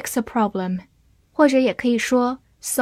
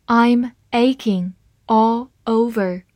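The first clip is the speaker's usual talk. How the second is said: slow reading; slower than usual. Shown as a demonstration. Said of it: "好，慢慢来读。".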